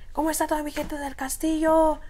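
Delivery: high, showy stage MC greeting; calling out to the crowd. Retold or imitated. Young rapper greeting the club crowd from stage, high MC hype call.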